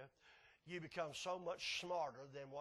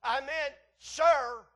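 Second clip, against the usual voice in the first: slow, loud, higher stern demand; stern daddy; 9 semitones higher, 14 dB louder, much slower than usual. Retold or imitated. Daddy demands the boy repeat himself, slow and stern. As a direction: drag it out like a warning father. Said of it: "here's what I heard".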